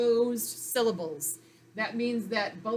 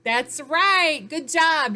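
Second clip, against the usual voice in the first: loud, higher-pitched congratulation; enthusiastic praise; 5 semitones higher, 9 dB louder, much slower than usual. Pretend. Teacher praises pupils for finding the dividing line, enthusiastic. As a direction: cheer the pupil on with a big, lifted voice.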